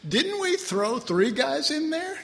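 a hint of a stunned king double-checking; astonished king. King asks his men in disbelief, mildly astonished. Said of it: "He said".

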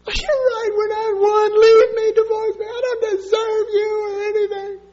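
high, crying, self-pitying plea; hammed-up weeping wife pleading divorce; mock sobbing wife. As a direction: wail it like a wife begging to be left. Wife's self-pitying plea, voiced.

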